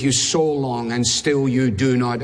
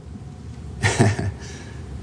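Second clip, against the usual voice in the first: a soft unvoiced laugh; breathy chuckle; 6 semitones lower, 5 dB quieter than usual. Real, not acted. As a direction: laugh quietly at the checkmate. Preacher laughs at the trap.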